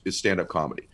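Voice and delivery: ordinary talk, nothing marked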